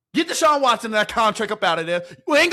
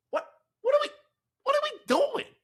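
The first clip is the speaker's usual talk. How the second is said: exasperated. Real, not acted. Exasperated at the team.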